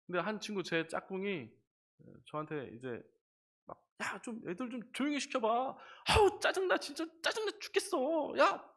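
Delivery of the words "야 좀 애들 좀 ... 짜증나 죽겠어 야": grumbling classmate. Retold or imitated. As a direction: whine like a fed-up classmate. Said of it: "엄청 투덜대는데".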